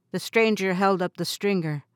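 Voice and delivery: usual speaking voice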